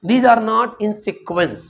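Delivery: very slow, each word spaced; slow dictation. Shown as a demonstration. Teacher stating the reason to the class, very slow. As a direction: spell out the reason slowly. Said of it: "reason क्या है".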